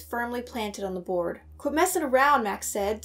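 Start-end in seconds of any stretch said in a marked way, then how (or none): 1.6-2.4 irritated, raised snap; somewhat angry. A narrator's reading. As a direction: tell him off sharply. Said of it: "somewhat angrily".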